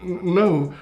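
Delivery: high, hesitant little no; stammered denial. Acted. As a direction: stumble into an unconvincing lie. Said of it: "I ain't no snitch".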